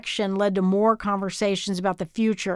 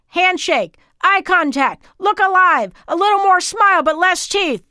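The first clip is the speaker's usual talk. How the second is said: high, loud coaching over music; coaching shout; 8 semitones higher, 6 dB louder than usual. Acted. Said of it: "coaching over the music".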